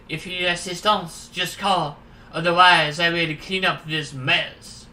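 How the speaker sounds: put-on courteous French-accented offer of help. Stranger offering assistance after the spill, French accent. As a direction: put on a French accent, drawing out the last words. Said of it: "we'll make him French".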